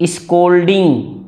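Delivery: स्कोल्डिंग with a clear hard k sound; model pronunciation. Shown as a demonstration. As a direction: stress the k in स्कोल्डिंग, s-kol-ding. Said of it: "सी के साथ ओ आएगा तो क हो जाएगा". (स्कोल्डिंग में क की साफ़ आवाज़)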